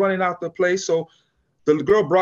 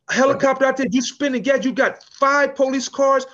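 higher, mock furious chief rant; hammed-up outraged boss; losing it; 8 semitones higher than usual. Police chief fuming at wasted cars and gas, mock furious. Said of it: "He was losing it".

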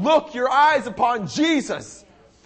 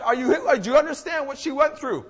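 ordinary talk

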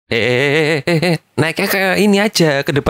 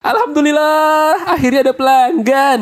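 overjoyed vendor; 12 semitones higher than usual. Acted. Vendor thankful, high voice.

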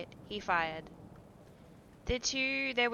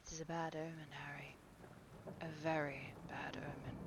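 unvoiced, much softer foreboding; whispered dread; 4 semitones lower, 13 dB quieter than usual. A narrator's reading. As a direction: breathe it with a heavy sigh. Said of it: "said with a heavy sigh".

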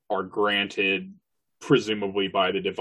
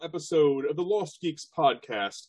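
divine voice; 7 semitones higher than usual. Acted. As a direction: greet them like a deity. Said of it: "that was my divine voice".

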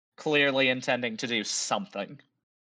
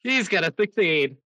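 quick, raised-pitch announcement; emphatic repeat; 4 semitones higher, 4 dB louder, faster than usual. Real, not acted. Game master to player who asked "he what", emphatic repeat. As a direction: say it fast, run the words together. Repeats after being misheard, run together.

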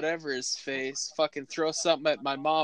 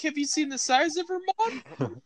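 incredulous question; 10 semitones higher than usual. Retold or imitated. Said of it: "I'm like".

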